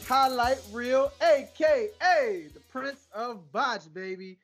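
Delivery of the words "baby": ordinary talk, nothing marked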